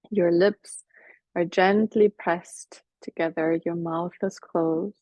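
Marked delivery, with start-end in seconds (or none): none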